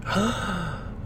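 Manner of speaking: a soft, quiet shocked gasp. Retold or imitated. Reacting to the coworker's wise line, a soft mock gasp. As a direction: draw a quick breath of mock shock.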